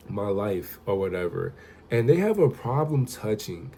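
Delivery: normal talking voice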